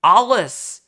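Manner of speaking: Alice said as AH-lis, loud and bright; broad ah vowel. Shown as a demonstration. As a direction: open the A in Alice into ah. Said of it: "I'll say my A's like ah".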